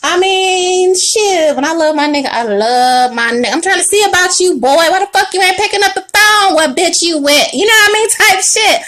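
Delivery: a jokey jealous guy voice; mock jealous man. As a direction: play the needy boyfriend calling out his girl. Acting the man's texting rant.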